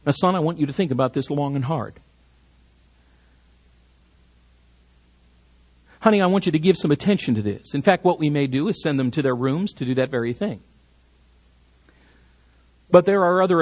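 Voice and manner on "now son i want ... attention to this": quick, understated parental advice; parent gently instructing. Acted. Parent to child after a teaching moment, low-key instruction. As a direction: mildly coax a child to reflect. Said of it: "follow it up by saying something like this".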